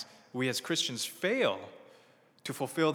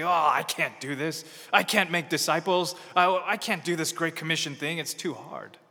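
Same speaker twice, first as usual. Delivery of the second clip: louder, whiny I-can't protest; despairing believer; 4 semitones higher, 6 dB louder than usual. Acted. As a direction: complain that it's too hard.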